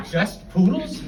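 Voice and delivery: indignant rising just poodles?; dog asking back, a little hurt; offended dog. Retold or imitated. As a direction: voice the dog's wounded pride. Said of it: "it's like he said to me".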